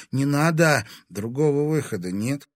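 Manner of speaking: ordinary delivery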